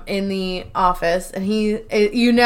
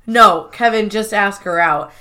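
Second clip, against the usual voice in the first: usual speaking voice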